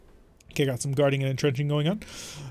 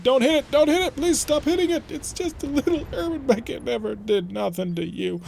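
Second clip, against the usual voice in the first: high-pitched, hammed-up begging; play-acted protest, pitched way up; mock pleading; 9 semitones higher than usual. Pleading for the little Urban Mech.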